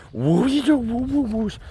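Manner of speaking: high cooing nonsense for a puppy. Real, not acted. Baby talk to the dog.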